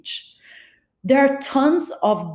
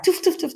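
dabbing sound effect; 8 semitones higher than usual. Retold or imitated. Imitating paper towel dabbing.

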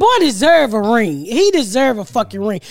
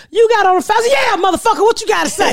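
retold confrontation; 10 semitones higher than usual. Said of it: "They was like".

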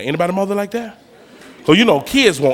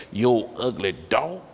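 lower, softer, slow muttered jab; mock muttered insult; 5 semitones lower, 5 dB quieter, slower than usual. Kid insulting boyfriend under breath.

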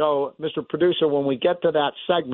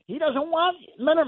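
normal talking voice

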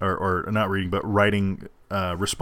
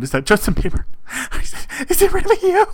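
high, loud fangirl squeal; screaming fangirl; 4 dB louder, faster than usual. Pretend. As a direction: shriek with starstruck delight. Starstruck greeting of [name].

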